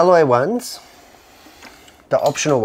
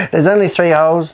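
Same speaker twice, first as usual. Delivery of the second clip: emotional; 4 semitones higher, 6 dB louder than usual. Real, not acted. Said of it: "no need to get emotional".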